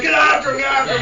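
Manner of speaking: high, loud frantic order; mock urgent shout. Conspirator sends accomplice after Rasputin, urgent shout. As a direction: holler it to an accomplice.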